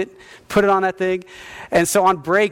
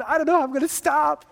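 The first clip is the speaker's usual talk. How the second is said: high, worried voice; mock panic; 9 semitones higher than usual. Pastor acting his scared self halfway down the line, high and worried. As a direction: sound suddenly unsure halfway down. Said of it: "I'm going".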